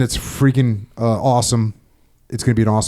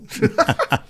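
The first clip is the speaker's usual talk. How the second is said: high laugh; 4 dB quieter than usual. Real, not acted. Laughing at his own story.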